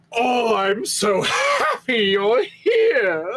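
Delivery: high, slow, gushing affection; overjoyed hug. Acted. Gorla hugging Geshuo, slowly gushing with joy. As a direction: smother him with adoring words. Hunk hugs Geshuo tightly.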